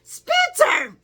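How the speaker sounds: high, loud scolding cry of his name; scolding shout. Retold or imitated. Laura scolding her grandson, high loud shocked cry.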